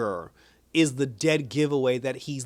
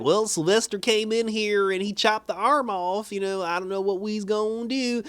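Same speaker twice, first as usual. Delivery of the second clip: high-pitched, put-on helpless mechanic; 8 semitones higher than usual. Mechanic tells Pat about the chopped arm, high put-on voice. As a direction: report it in a high, hammed-up character voice.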